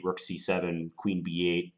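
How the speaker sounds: ordinary delivery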